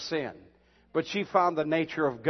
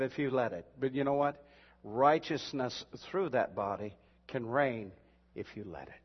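hushed scripture reading; 2 semitones lower, 3 dB quieter than usual. Acted. Quiet slow scripture reading.

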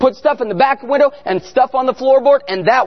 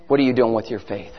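much lower and softer, searching question; hushed challenge; 13 semitones lower, 7 dB quieter than usual. Real, not acted. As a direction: drop right down to press the question home. Same challenge repeated low and quiet.

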